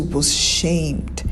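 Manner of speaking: ordinary delivery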